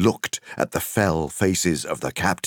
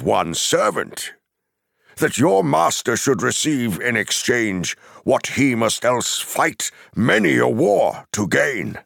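high, forceful challenge from a wizard; stern, ringing rebuttal; indignant Gandalf; 6 semitones higher, 4 dB louder than usual. Acted. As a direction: challenge the enemy's demands with rising indignation. Gandalf challenges the messenger's demands.

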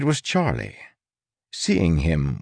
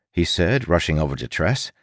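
usual speaking voice

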